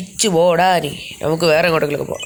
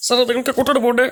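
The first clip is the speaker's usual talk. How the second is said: higher, lightly voiced ticket clerk; put-on clerk voice; 5 semitones higher, faster than usual. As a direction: play the airport clerk, pitched up. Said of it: "എന്തേ ശബ്ദം ഇടയ്ക്കിടയ്ക്ക് മാറ്റി".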